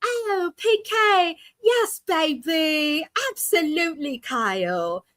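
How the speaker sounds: put-on high, slow, drawn-out affected accent; Dorit. Recalling how the housewife used to talk, mock affected accent. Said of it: "last season she used an accent".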